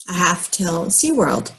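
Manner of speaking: usual speaking voice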